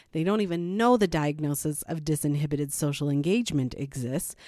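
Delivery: ordinary talk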